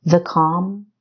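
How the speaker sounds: usual speaking voice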